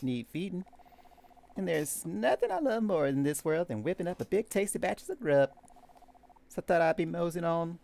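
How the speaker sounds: put-on high-pitched, animated country-accented cook. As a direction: put on a chatty country cook's twang. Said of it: "I did a country accent".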